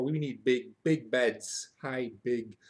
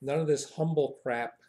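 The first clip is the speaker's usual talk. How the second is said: normal talking voice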